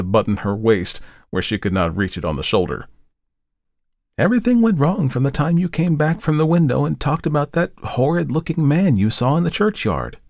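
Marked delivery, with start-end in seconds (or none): 4.2-10.2 put-on higher young woman declaring; announcing. A narrator's reading. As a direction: declare it to him. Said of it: "she announced".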